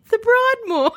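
high incredulous laugh-shout of the name; laughing disbelief. Retold or imitated. Retelling the caller's hotel suggestion, laughing in disbelief. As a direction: squeal the name through laughter.

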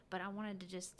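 ordinary talk

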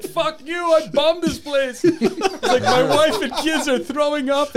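high, loud mock Arabic accent; imitated Arabic accent. Retelling a Jerky Boys call, mock Arabic accent. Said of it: "doing it in an Arabic accent".

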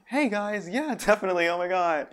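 high, loud imitation of an actor friend; castmate's acting voice. Imitating castmate's voice in acting, high and loud. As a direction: lift the voice way up, projecting. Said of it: "in acting, her voice is like".

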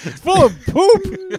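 high, loud and slow; loud mock outrage. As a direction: shout it in mock disgust. Joking about gift contents.

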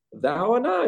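high, hammed-up character voice; Igor. Podcaster demonstrating Igor's old accent to co-hosts, high impression. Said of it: "Igor's accent was always like".

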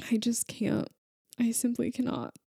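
whispered, too moved to go on; a real choked-up whisper; overcome whisper. Real, not acted. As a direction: breathe it out, overwhelmed. Overwhelmed by a sweet story.

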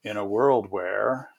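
lightly voiced trailer narrator, slow and measured; a hint of booming trailer announcer, drawn out; movie trailer voice. Consultant imitating a film trailer's opening line, lightly put-on announcer voice. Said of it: "begins with a deep boom-, booming voice".

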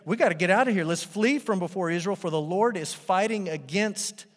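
high, loud panic; urgent cry to flee; panicked Egyptians. Retold or imitated. Egyptians fleeing Israel, loud panicked cry. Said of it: "They say".

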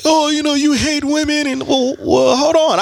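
high mocking accuser voice. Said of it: "it's like".

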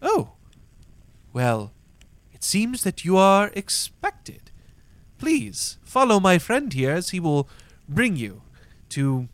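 high host voice, easing toward the end; gracious inn greeter. Acted. Inn host learning the guests are expected, graciously obliging. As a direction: usher them in with courteous surprise. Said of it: "and he goes".

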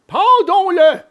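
high, loud villain order; eager assassin. A narrator's reading. One assassin urging the other to hang him, loud and high.

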